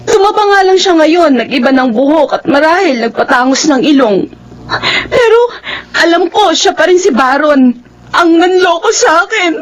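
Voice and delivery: high, crying voice, words breaking; tearful distress. Acted. Niece tells her aunt she saw her ex, crying. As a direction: fight through the sobs to get the words out.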